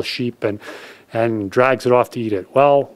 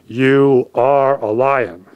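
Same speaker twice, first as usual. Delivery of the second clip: lion commanding; much slower than usual. A narrator's reading. Lion shoves face in pool.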